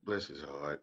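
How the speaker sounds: low bless his heart; sweetly sarcastic pity; Southern lady. Retold or imitated. A Southern woman calling someone an idiot. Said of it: "if you hear".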